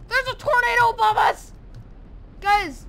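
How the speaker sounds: high, loud panicked yell; alarmed shout. Real, not acted. Gamer yelling to viewers about a tornado, alarmed. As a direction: cry out in alarm.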